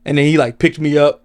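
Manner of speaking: ordinary delivery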